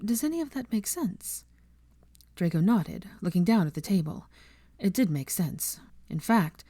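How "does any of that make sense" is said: soft, higher pleading question. A narrator's reading. Hermione pleading with Draco for understanding, soft. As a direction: beg him to understand. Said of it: "a pleading look".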